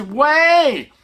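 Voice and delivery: high, emphatic comeback; emphatic insistence. Retold or imitated. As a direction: fire it back with total conviction. Husband insists back.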